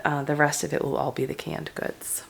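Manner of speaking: ordinary talk, nothing marked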